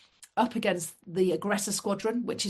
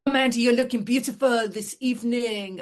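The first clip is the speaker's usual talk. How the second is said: put-on higher, louder amateur French accent; Michel, French accent; 5 semitones higher, 5 dB louder than usual. Navigator complimenting her before dinner, French accent. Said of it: "this is a French accent".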